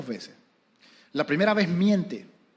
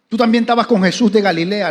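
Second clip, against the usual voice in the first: accusing maid; 5 semitones higher, 10 dB louder than usual. Retold or imitated.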